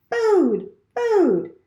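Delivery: a starving chick squeaking for food; hungry gosling. A narrator's reading. Gosling begs goose for food.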